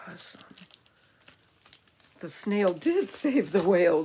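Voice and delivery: ordinary delivery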